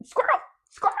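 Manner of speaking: very high breathy yelp of squirrel, squirrel; high excited dog voice; distracted dog. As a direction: squeak it like the dog from Up spotting a squirrel. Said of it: "I'm like the dog from Up".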